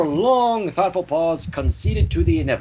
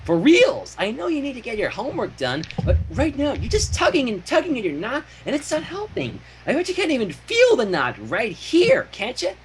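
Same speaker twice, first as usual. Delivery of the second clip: higher, bubbly cartoon pony voice; Pinky voice; 6 semitones higher than usual. Reader voicing Pinky nagging Twilight, higher and bubbly. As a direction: bounce it up like an eager pony.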